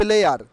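"player" ending in a sounded R; wrong pronunciation. Shown as a demonstration. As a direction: say "player" as loud "ple-ar". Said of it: "not player". ("player"-এর শেষে র উচ্চারণ করে)

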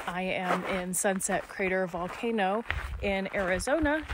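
usual speaking voice

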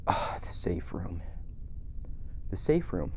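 hushed whispered discovery. Real, not acted. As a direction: breathe it out in awe. Whispered realisation.

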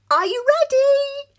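calling from another room, high and loud. Acted. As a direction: call out to Fish. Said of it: "Cake called from the bathroom".

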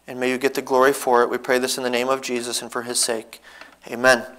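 soft, reverent prayer, often trailing quiet; earnest prayer. Real, not acted. Pastor praying for the congregation before the sermon, soft and reverent. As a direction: pray humbly over the church.

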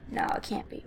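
breathless whisper to himself. Real, not acted. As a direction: mutter it under your breath. Doubting himself quietly.

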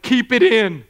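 mock-scolding plea from mother and wife; mother and wife warning. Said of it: "my mother and my wife are like".

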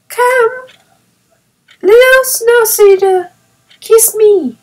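put-on little boy inviting a kiss; excited small child; eager cry. A narrator's reading. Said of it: "cried Peony".

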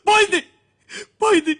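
a high, loud cry of pain on the word; jilted lover's loudest cry before his voice collapses; anguished shout. Acted. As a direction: shout it out in heartbreak. Repeating "she's gone" in anguish.

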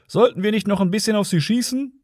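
high-pitched, casual call to a partner; calling over. A narrator's reading. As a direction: suggest it across to the other cop. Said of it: "rief dem anderen zu".

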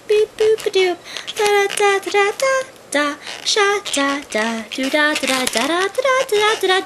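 squeaky retro game noises sung out; video game beeps. Pretend. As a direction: play the game music with your voice. Imitating Game Boy game sounds.

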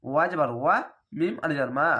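ordinary talk, nothing marked

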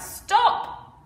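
sudden high shout of stop. Acted. Hat seller shouting at the monkeys, loud high yell. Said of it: "Before the man could shout".

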